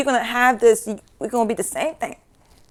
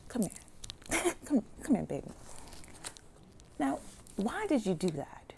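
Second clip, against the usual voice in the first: tender whisper; 2 semitones lower, 16 dB quieter than usual. Retold or imitated. Tender spirits voiced.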